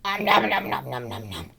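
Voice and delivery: hammed-up nom nom; munch the words like a greedy eater; mock chewing. Ambassador eating noisily.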